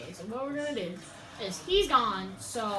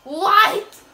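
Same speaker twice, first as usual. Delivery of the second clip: shocked shout; 7 semitones higher, 16 dB louder, much slower than usual. Real, not acted.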